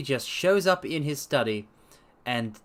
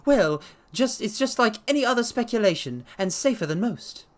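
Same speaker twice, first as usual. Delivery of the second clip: Blessington voiced; 8 semitones higher than usual. A narrator's reading. Blessington probing the doctor's habits, high sharp voice. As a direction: poke at him, high and sharp.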